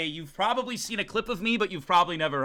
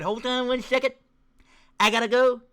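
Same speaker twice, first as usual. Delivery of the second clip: acted excuse; 5 semitones higher, 4 dB louder than usual. Acts out viewers excusing themselves.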